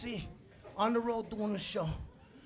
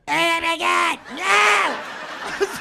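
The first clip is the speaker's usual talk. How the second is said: high, loud mock panic cry; panicked tripping man; 8 semitones higher, 12 dB louder, much slower than usual. Imitating the little man tripping, a high loud mock panic. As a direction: cry out as you start to fall. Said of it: "he's like".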